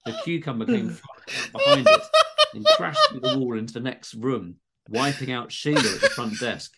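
reading through laughter; laughing while reading. Real, not acted. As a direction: fight back laughs as you read.

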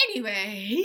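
smooth newsreader segue; news reporter voice. Shown as a demonstration. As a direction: say it like a news anchor. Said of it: "news reporter voice".

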